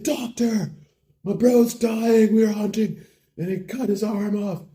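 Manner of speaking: high, wailing cry for help; stretched, frantic hollering; mock panic. Bro bursts into hospital begging a doctor, panicking.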